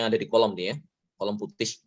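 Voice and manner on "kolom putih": normal talking voice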